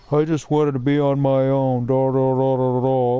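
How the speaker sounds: droning mock excuse; mocking imitation. Player mimicking his friend's excuse for leaving, mocking drone. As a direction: imitate a whiny excuse in a monotone blah-blah.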